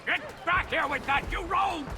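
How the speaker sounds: high, strained yell at a fleeing rogue; indignant chase cry; shouting after thief. Acted. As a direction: call out in outrage as he runs off. Robbed man chases the thief.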